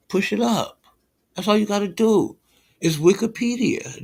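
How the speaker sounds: high, sing-song taunting tone; mocking exasperation. Real, not acted.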